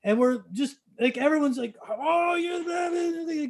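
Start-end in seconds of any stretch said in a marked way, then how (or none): 1.9-3.5 high, long drawn-out gushing cry; imitating cheering crowd. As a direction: voice a crowd hailing its hero. Whole bar cheers the generous guest.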